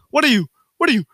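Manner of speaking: high, loud hammed-up scolding; imitated angry yelling. Mother yelling at her daughter, imitated angry yell. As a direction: build to a mock-outraged shriek on the last word. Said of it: "we just gotta sit there while she's yelling".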